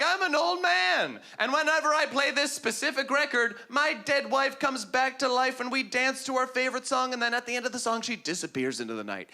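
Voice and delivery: lightly voiced old man telling a story. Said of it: "your average ghost story would be like".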